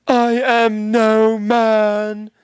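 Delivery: very slow, high and loud declaration; hammed-up heroic proclamation; epic movie line. Quoting the movie to his friend, hammed-up and drawn out. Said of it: "when she's like".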